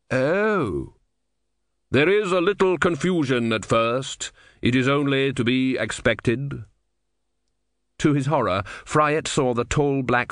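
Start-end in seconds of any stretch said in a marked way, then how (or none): 0.1-6.6 grave and measured, like Death. A narrator's reading. Death speaks.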